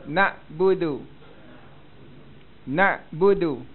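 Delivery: "Nak budu" measured, with a careful "d"; soft dal. Shown as a demonstration. Teacher leads students, careful soft "d" in "Nak budu". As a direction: give "Nak budu" deliberately for repeating. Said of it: "cuba sebut".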